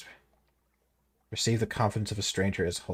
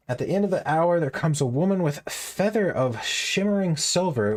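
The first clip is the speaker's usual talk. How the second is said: dramatic narration; 8 semitones higher than usual. A narrator's reading. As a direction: read it like a grand reveal. Reading game text with emphasis.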